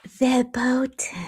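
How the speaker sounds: slow, drawn-out mock Cody; imitating Cody. Vlogger mocking how Cody says verboten, slow imitation. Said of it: "That's how he says it".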